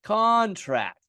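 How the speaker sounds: "Contract" as CAWN-tract, first syllable high and stressed; long first vowel. Shown as a demonstration. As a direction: lean on a long "aw" in CON. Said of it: "this is a long aw sound".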